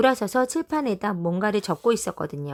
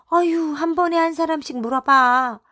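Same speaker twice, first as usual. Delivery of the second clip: louder, higher overwhelmed cry; exasperated shout; 7 semitones higher, 5 dB louder than usual. A narrator's reading. Lookout mom overwhelmed by questions, shouting. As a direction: push them back, one at a time. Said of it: "그렇게 소리치고는".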